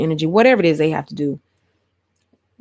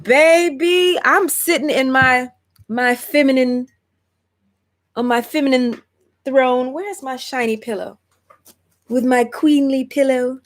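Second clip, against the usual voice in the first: high-pitched, hammed-up diva on her throne; playful queen; 7 semitones higher than usual.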